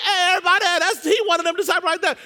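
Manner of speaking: high, fast holler to the whole crowd; calling out loudly. Acted. Accuser pointing Peter out to everybody, hollering fast. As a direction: raise the alarm on him.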